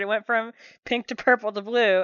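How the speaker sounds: ordinary talk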